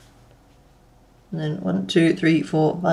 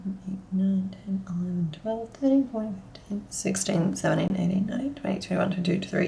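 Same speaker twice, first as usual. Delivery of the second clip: counting stitches in a near-silent whisper; 14 dB quieter than usual. Real, not acted. Crafter counting stitches quietly to herself, whispering. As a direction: breathe the numbers out under your breath.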